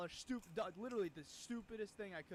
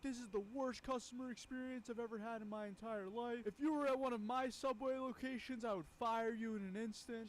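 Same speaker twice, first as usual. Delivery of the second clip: mock angry rant; 5 semitones higher, 3 dB louder than usual. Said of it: "freaks out at me".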